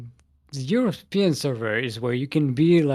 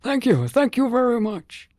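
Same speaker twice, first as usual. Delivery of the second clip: thanks pitched up high and bright; high playful thanks; 10 semitones higher than usual. Retold or imitated. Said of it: "comparing my old man voice".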